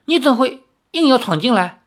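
high, puzzled challenge; baffled. A narrator's reading. Gai Luojiao baffled by the intruder, high puzzled question. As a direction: demand to know why he barged in. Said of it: "十分不解".

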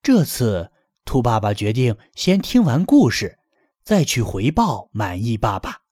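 ordinary delivery, nothing marked

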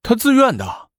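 high-pitched retort; protesting. A narrator's reading. Prince's cousin defends the volunteer's wish, protesting. Quarrel over the raised blade.

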